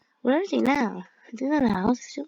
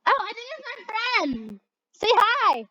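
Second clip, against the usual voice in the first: high, excited call to a friend; excited greeting; 11 semitones higher than usual. Pretend. Kid spots a friend and calls excitedly. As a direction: squeal hello to the friend.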